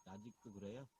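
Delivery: barely audible phone line; very low, distant phone voice; faint connection. Real, not acted. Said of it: "안 들리는 건 아닌데 너무 적어". (멀리서 작게 들리는 통화 소리)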